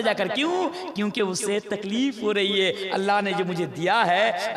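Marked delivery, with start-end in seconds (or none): none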